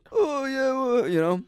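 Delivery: high, nervous appeasing tone; awkward high agreement. Retold or imitated. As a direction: squeak out a coward's yes. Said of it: "a part of me was just like".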